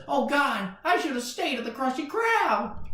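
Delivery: high-pitched mock dismay; regretful cartoon voice. SpongeBob regrets leaving work, high cartoon wail. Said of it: "And he says".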